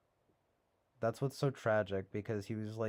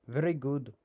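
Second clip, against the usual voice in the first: put-on light Indian accent; a hint of an Indian accent on "very good"; 4 semitones higher than usual. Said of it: "that was actually kind of an Indian accent".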